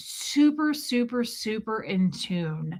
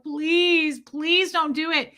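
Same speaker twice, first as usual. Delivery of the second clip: higher, imploring mock-tearful begging; retold bawling plea; 6 semitones higher, slower than usual. Daughter begging dad about the cat, retold bawling plea. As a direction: plead as your upset younger self, retold. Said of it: "I was bawling".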